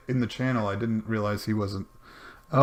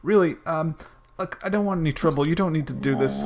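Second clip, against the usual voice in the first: higher-pitched, pleading non-player character; protesting captive; 7 semitones higher, 3 dB louder than usual. Acted. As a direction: talk your way out of trouble. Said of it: "Uh, he says".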